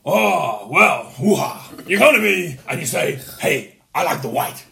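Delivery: drawn-out hoo-ha film-star bluster; Al Pacino impression. Answering the favourite kit question, hammed-up Pacino impression. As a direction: ham it up like an overacting American star. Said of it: "as Al Pacino".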